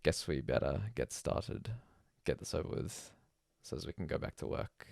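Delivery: usual speaking voice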